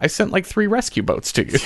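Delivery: mock God, fed up with the man; God in the joke. God answering the drowned man in the joke, a light exasperated voice. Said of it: "God said".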